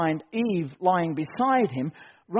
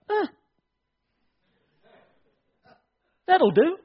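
a higher, dry so-so verdict; mock unimpressed shrug; 12 semitones higher, 4 dB louder than usual. Adam pretending Eve is just okay, mock shrug. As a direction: play it as mildly underwhelmed. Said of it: "He didn't just look at her and go".

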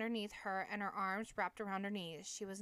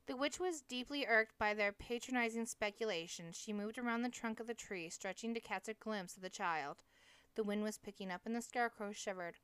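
plain reading voice

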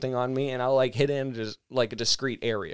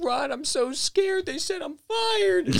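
put-on high, slow wail of a panicked teenager; scared, distressed; slower than usual. Upset young coworker tells friend he's fired, high mock distress. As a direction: imitate a frightened kid, pitched way up and drawn out. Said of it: "it's how you sounded during it".

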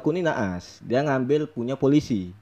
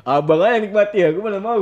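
raised, higher-pitched retold comeback; indignant retort; 7 semitones higher, 6 dB louder than usual. Retelling his comeback to an older detainee, indignant. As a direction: throw it back loudly in protest.

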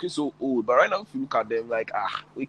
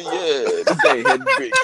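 laughing through the words, louder at the end; cracking up at the memory; laughing retelling; 6 dB louder, much slower than usual. Retold or imitated. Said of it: "you see, say".

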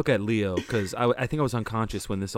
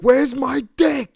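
mock panic; 9 dB louder, much slower than usual. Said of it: "when you were like".

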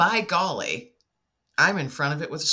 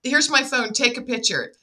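high, loud, rushed insistence; excited urgency; 11 semitones higher, 5 dB louder than usual. Retold or imitated. Telling her brother to take the picture, high excited urgency. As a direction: push past him, eager to get out.

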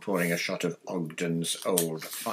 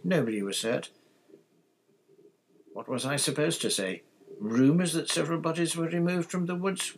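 rising, higher-pitched indignant questions; exasperated; 9 semitones higher than usual. A narrator's reading. Father defending himself after reading the paper, exasperated. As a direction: climb in pitch with disbelief. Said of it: "in exasperation".

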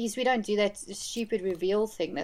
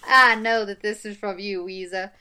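put-on awful Southern accent; 5 dB louder than usual. Quoting a movie character on the bridal shower gift, awful Southern accent. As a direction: do a bad American Southern accent. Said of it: "my awful Southern accent".

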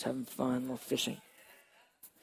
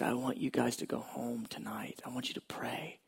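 softer, whispered urging; quiet, earnest whispered appeal; intimate whisper; 8 dB quieter than usual. Retold or imitated. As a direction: lean in and whisper the plea.

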